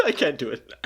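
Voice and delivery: high, giggling words after a failed attempt; laughing through it. Real, not acted. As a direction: laugh the line out loud.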